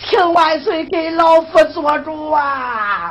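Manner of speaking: slow, crying appeal to the emperor; old minister begs the emperor for justice, weeping; tearful plea. Acted. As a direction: drag it out as a sobbing plea.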